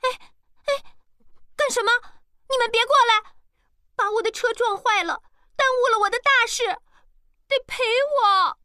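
very high, half-crying wail; shrieking outcry. A narrator's reading. Girl backs away demanding compensation, high tearful yelling. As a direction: wail and yell with show tears. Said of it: "开始叫喊".

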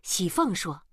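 ordinary delivery

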